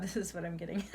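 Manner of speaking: words tipping into a laugh; real laugh creeping in; breaking into laughter. Real, not acted. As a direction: start to laugh as the line ends. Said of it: "I should not be laughing".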